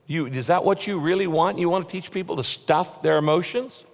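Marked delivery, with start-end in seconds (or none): none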